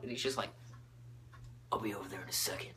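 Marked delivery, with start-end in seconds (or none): 1.7-2.7 low, soft, husky put-on teacher voice; hushed hero murmur, pitched down; Batman. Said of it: "that's just the voice I'm gonna give him".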